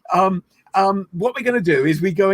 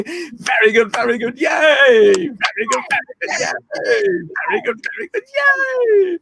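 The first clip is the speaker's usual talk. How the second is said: put-on childlike playful cheer; 11 semitones higher than usual. Shown as a demonstration. Leader gets the class to drop inhibitions, playful cheering. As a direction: whoop it like a delighted kid. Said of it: "a way of expressing childlike playfulness".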